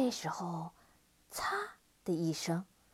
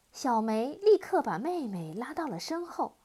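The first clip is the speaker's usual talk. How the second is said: usual speaking voice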